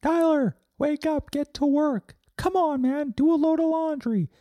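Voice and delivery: a pushy little number nagging you to get up; high-pitched nag from the number forty-four. Acted. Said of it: "That's what forty-four does".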